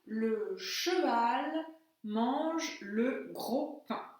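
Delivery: each word spaced out slowly; teacher dictates a sentence to translate, slowly; slow dictation. Shown as a demonstration. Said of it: "Traduisez-moi la phrase".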